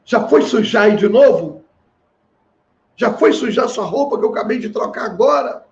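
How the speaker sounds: mock scolding. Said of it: "Quer dizer".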